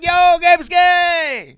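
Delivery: long, drawn-out high shout; very loud, stretched yell; scream. Shown as a demonstration. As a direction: holler it across the street. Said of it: "just scream".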